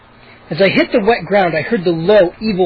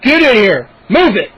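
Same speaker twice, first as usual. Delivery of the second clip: deep angry voice; 4 semitones higher, 6 dB louder than usual. A narrator's reading. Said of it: "in a deep, angry voice".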